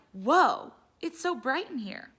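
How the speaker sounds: wide-eyed gasp of wonder at the brightness; child's amazed exclamation; amazed whoa. Acted. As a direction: marvel at the sudden brightness.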